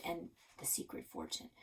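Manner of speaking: ordinary delivery, nothing marked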